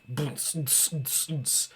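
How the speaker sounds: softly beatboxed thumping dance music; imitated club beat. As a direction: make a boom and hissing hi-hat beat, voiceless on the tss. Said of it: "the most bumping music, like".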